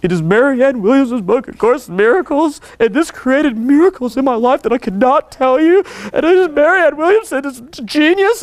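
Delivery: high, wobbly devotee gushing through tears; mock tearful trembling. A fan pressing a treasured book on him, mock trembling in tears. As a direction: imitate someone tearfully overcome, voice climbing. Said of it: "trembling in tears".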